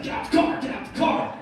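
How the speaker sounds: high, strained mock order; mock angry demand. Angry man beelining for the driver's door, mock demand. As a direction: repeat it urgently, imitating an attacker.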